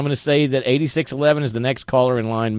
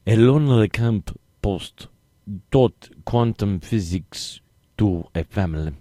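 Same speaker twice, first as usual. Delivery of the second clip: put-on slow, heavy Russian accent reading; 6 semitones lower, 4 dB quieter, slower than usual. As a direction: read it like a thick-accented Russian. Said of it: "that was very clearly, uh, Russian".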